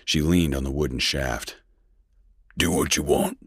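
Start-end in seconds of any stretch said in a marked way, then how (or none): 2.6-3.4 rasped. A narrator's reading. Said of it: "the colonel rasped".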